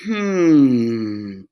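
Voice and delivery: low, deep thinking hum; troll considering; troll musing hum. Acted. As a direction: weigh it up. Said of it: "thought the troll".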